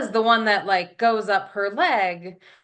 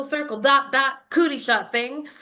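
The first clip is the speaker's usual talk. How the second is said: usual speaking voice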